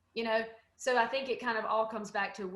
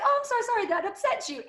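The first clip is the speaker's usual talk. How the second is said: sugary mock-sorry, quick and high; sarcastic apology; 10 semitones higher, 4 dB louder, faster than usual. Brushing off the troll with a sarcastic apology. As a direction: fake sympathy tossed off lightly. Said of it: "I was like".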